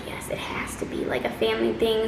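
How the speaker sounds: hushed whisper. Retold or imitated. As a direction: whisper it like a secret. Telling Mimi's owner it's family.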